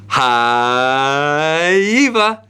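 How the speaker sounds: long drawn-out rising hello. Real, not acted. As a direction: sing out a big hello to a little fan. Greeting a child in recorded message.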